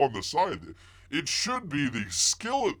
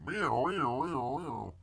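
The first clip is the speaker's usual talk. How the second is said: wailing siren noise made by mouth; repeated high alarm wail; imitated fire alarm; 3 semitones higher, 4 dB quieter, much slower than usual. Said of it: "the fire alarms goes off".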